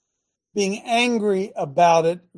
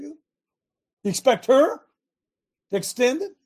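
incredulous; 8 semitones higher, 4 dB louder, slower than usual. Retold or imitated. Incredulous rhetorical question.

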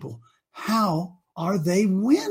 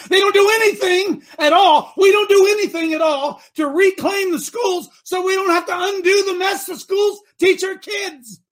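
fervent shout; 7 semitones higher than usual. Real, not acted. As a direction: preach it at full force. Preacher building to fervent shout.

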